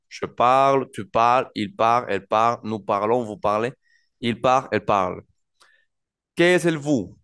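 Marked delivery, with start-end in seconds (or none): 0.1-5.2 "Je parle" dicho con cada pronombre marcado; conjugación modelo en francés. Shown as a demonstration. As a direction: recita la conjugación con claridad. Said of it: "fundamental decir". (el profesor recita la conjugación completa de "parler")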